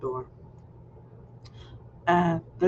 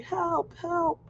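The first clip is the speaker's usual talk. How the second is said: a kid's high-pitched call for help, only imitated; mock scream for help; 12 semitones higher than usual. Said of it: "So then I started screaming".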